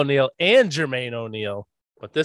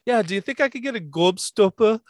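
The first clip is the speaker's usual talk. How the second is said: high-pitched, put-on English accent; Tyler imitating English accent; 7 semitones higher than usual. Dad imitating his son asking for a Gobstopper, high English accent. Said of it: "that's how Tyler used to say it".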